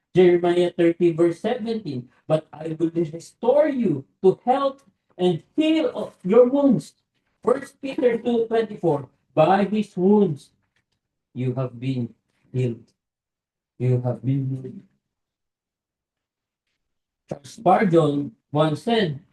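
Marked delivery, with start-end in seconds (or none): 2.3-7.0 scripture reading. Acted. Reading healing verse slowly. 9.3-14.8 solemn reading. Acted. Reading verse, fading soft.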